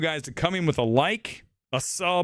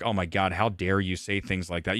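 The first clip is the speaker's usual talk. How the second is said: mock disapproving guy; 8 semitones lower, 3 dB quieter than usual. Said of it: "some guy looking at me like".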